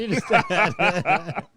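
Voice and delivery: very slow, high, loud through laughter; stretched-out giggling kid voice; laughing echo. Retold or imitated. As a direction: repeat it cracking up. Echoing the kid line, laughing.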